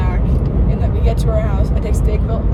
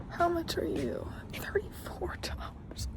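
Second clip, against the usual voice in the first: whisper; 9 semitones higher, 21 dB quieter, slower than usual. Real, not acted. Quiet aside in a store.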